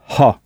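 ha with a breathy English h; h aspiré; aspirated h. Shown as a demonstration. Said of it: "avec un H aspiré".

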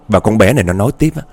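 ordinary delivery, nothing marked

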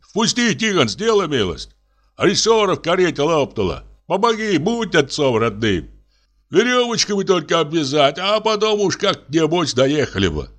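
high, imploring plea through a door; pleading coachman. Acted. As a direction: beg to be let in out of the cold.

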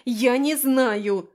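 loud, slow exasperated cry; shouted out. A narrator's reading. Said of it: "в очередной раз выкрикнула я".

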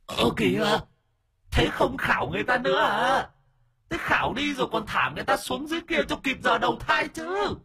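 a spirit indignant at being left; high ghost voice rising to a yell; whining ghost calling out. Acted. Ghost yells after the masters.

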